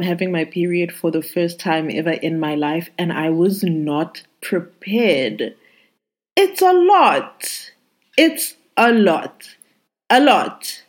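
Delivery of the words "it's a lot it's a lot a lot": overwhelmed. Real, not acted. Overwhelmed by her first period.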